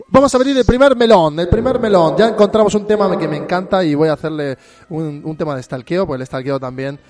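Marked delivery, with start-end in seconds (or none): 0.0-2.1 loud, high, excited opening of the topic; excited announcement. Real, not acted. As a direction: shout it out like a game show host. Host excitedly opens topic.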